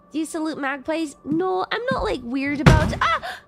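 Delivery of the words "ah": startled yelp. Real, not acted. Startled mid-sentence in game.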